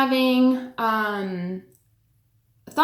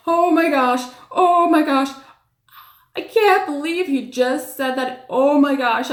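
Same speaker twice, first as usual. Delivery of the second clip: high, loud excited gasp of disbelief; inner freak-out; 4 semitones higher, 4 dB louder than usual. Retold or imitated. Caregiver reliving her shock at the patient's words, excited and loud. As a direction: hold in a shocked squeal, louder and higher.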